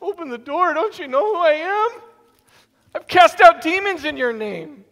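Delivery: high, indignant demand; high protesting plea at the door; indignant pleading. Acted. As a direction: plead with rising offence. Insistent pleading.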